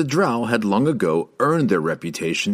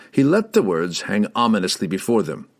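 ordinary delivery, nothing marked

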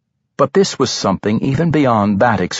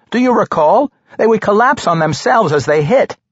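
high, raised halfling voice; excited explaining; 11 semitones higher, 3 dB louder than usual. A narrator's reading. Halfling explaining himself to startled barbarian, excited. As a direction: explain eagerly in a lifted voice.

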